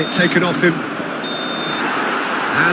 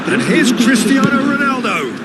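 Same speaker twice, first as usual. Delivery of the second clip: higher, shouted commentary; excited player call; 5 semitones higher than usual. Real, not acted. Commentator announcing Cristiano Ronaldo on the attack, excited. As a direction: shout the name as the attack builds.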